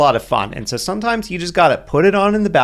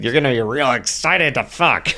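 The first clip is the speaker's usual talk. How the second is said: put-on creepy old man voice; 2 semitones lower than usual. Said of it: "Then I just sound like a creepy old man".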